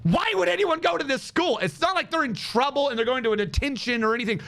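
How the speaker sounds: usual speaking voice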